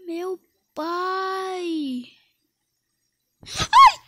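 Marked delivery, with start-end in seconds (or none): 3.5-4.0 high shriek of fright; jump-scare yelp; startled scream. Real, not acted. Sudden scare in game. (grito de susto)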